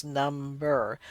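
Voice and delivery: "Number" split into two drawn-out syllables. Shown as a demonstration. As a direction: say "Number" as two distinct jaw movements. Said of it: "your jaw moves twice".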